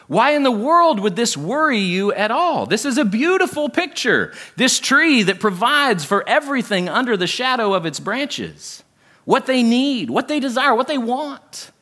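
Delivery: high-pitched, incredulous imagined reaction; puzzled disbelief. Retold or imitated. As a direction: voice the baffled reader, pitch climbing. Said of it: "we're like, "Why is this concerning".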